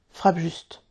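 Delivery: restrained, resolute command; firm. A narrator's reading. Laguigne tells Peter to strike true, firmly. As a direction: keep it steady and decided. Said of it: "dit-il d'un ton ferme".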